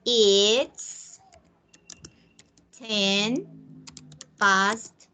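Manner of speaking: "It's ten past nine" with long pauses between words; slow model answer. Shown as a demonstration. Teacher modelling the answer word by word for the class. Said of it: "So what time is it?".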